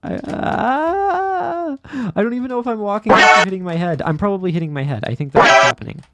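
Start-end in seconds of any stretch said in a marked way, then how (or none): 0.0-1.8 startled high yelp. Real, not acted. Startled by falling. 2.1-5.0 panicked rush. Real, not acted. Panicked fast rambling mid-game.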